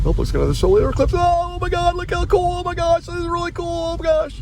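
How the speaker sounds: high fast gushing; mock excited gushing. Vlogger mocking tourists at an eclipse, gushing high. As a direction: gush like an awestruck tourist.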